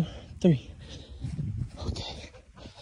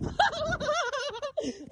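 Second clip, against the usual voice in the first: a long high laugh that fades; high breathless laugh; 8 dB quieter than usual. Real, not acted. Stuck in the chicken coop, high breathless laugh. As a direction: laugh in a squeal at your own predicament.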